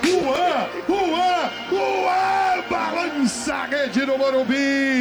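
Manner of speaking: screaming goal call; explosive goal commentary. Acted. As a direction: belt out the goal.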